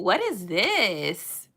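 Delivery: usual speaking voice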